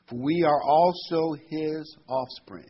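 "For we are also his offspring" read slowly, with offspring leaned on hard; slow down and land on "offspring"; stress on offspring. Shown as a demonstration. Preacher reading scripture to his church, stressing "offspring". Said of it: "I want to emphasize the word offspring".

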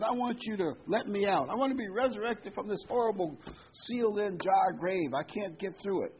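high, pleading genie voice, voiced up; a trapped genie begging to be let out; lightly hammed-up genie plea. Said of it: "genie says".

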